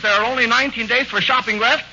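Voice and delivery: ordinary delivery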